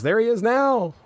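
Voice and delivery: high-pitched retold cry of alarm; mock yell. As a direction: yell it in a high voice, imitating a startled character. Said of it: "Alf yells".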